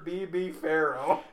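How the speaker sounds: ordinary talk, nothing marked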